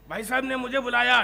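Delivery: normal talking voice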